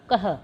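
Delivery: कह ending in a breathy h from the throat; breathy kah. Shown as a demonstration. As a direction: let कह end on a puff of breath from the throat. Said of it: "है की आवाज़ जो है वो आएगी". (गले से निकली ह की आवाज़ वाला कह)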